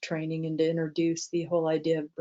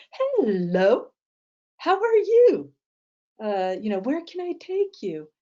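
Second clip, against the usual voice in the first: much higher, louder bright hello; cheery driver; 7 semitones higher, 4 dB louder than usual. Retold or imitated. Cheerful taxi driver greets arriving passengers, much higher and beaming. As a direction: greet them like old friends, beaming. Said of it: "so he was just so wonderfully positive".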